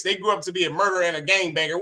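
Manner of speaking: normal talking voice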